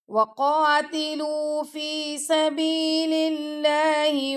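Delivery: slow, melodic tajweed recitation; Quran recitation. Shown as a demonstration. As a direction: chant the verse slowly and evenly, teaching by example. Teacher recites Quran verse.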